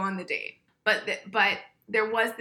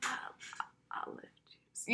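breathless giggling whisper; laughing whisper; 9 semitones higher, slower than usual. Real, not acted. Cracking up while repeating the line, whispered. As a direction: laugh the words out under your breath.

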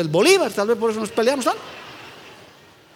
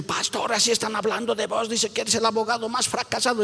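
hushed report; 5 dB quieter than usual. Retold or imitated. Deacons report gossip in hushed tone.

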